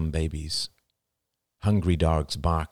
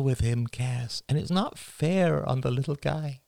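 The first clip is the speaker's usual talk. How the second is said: a touch of choked-up strain, pitched up; voice thick with emotion; 8 semitones higher than usual. A narrator's reading. Father confesses the day is a battle, mildly choked up. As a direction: hold back tears through the list. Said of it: "His voice thickened with emotion".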